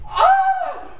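high shocked yelp of disbelief; shocked scream. Retold or imitated. Retelling the donkey shooting, a high shocked yelp. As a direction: shriek in sudden horror.

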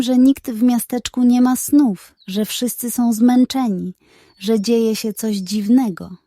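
plain reading voice

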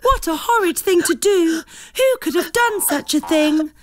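Dolly appalled at the scribbled cards, high shocked voice; terribly shocked. A narrator's reading. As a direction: gasp it out, appalled. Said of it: "sounding terribly shocked".

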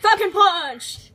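drawn-out, high attack shout; battle cry. Pretend. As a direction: bellow the special move slowly and loudly. Calls out a game attack.

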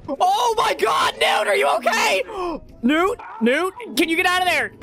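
high, urgent shout; alarmed calling out. Real, not acted. Player checking on a trapped friend, high alarmed shouts. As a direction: call frantically to a trapped friend.

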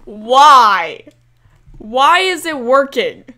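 loud despairing why; exasperated cry. Real, not acted. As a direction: protest loudly at the game. Glitch works during recording.

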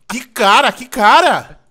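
fed-up yelling at a friend; exasperated. Real, not acted. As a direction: throw up your hands.

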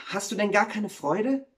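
high-pitched, hurt question; dismayed. Acted. Wife questions the gloomy miller, dismayed. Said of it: "sagte die Müllerin betroffen".

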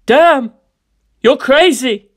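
loud, high, excited outburst; amazed shout. Acted. Buyer shouting at the dealer's stunt. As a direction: yell it in disbelief. Reacting to wild drug boast.

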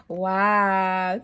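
plain reading voice

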